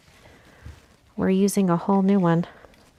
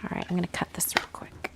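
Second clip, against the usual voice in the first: whispering; 9 dB quieter, much faster than usual. Real, not acted. Hushed aside while working.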